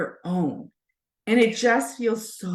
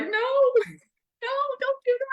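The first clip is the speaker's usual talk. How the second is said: much higher, laughing mock protest; mock horrified no; slower than usual. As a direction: playfully recoil as if alarmed. Mock reply to teen's bad idea.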